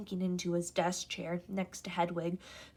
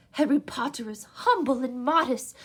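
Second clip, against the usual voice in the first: eager house elf; 5 semitones higher, 6 dB louder than usual. A narrator's reading. House elf meeting Harry, high and eager. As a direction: gush like an awed little elf. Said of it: "his orb-like eyes aglow".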